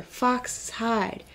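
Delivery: usual speaking voice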